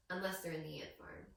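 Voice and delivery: ordinary talk, nothing marked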